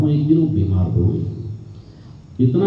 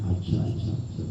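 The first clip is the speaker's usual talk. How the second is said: whisper; 14 dB quieter than usual. Acted. Khizr answers, barely voiced.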